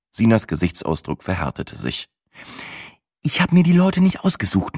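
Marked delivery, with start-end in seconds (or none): 3.2-4.8 much higher, defensive female voice; Sina's voice. Acted. Sina arguing with Matze, higher voiced. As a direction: defend yourself sharply.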